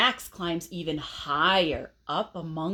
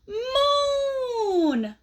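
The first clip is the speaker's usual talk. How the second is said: gasping wonder; 12 semitones higher than usual. A narrator's reading. Moon appears from clouds.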